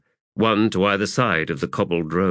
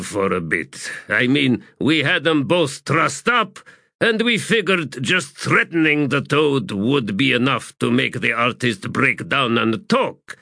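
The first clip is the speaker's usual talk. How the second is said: rambling sapper; 6 semitones higher than usual. Acted. Shaman explaining the roasting to corporal, rambling.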